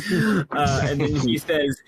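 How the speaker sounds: ordinary delivery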